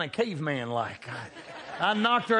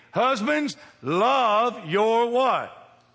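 loud, higher and very slow call for the answer; preaching shout; 5 semitones higher, 5 dB louder, much slower than usual. Real, not acted. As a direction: hold each word and throw it to the congregation. Preacher calls for response.

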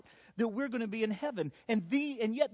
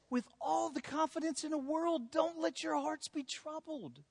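confident comfort; 6 semitones higher than usual. Acted. Jesus calmly telling disciples not to worry. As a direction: deliver it with total confidence. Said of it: "But Jesus says".

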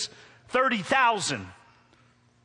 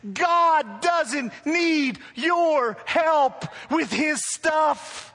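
loud, higher, driving sermon voice; preaching at full force; 8 semitones higher, 3 dB louder than usual. Acted. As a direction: press the point home to the church.

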